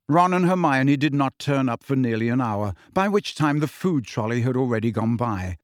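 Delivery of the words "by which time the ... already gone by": usual speaking voice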